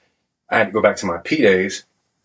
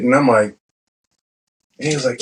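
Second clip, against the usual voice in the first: ordinary talk, nothing marked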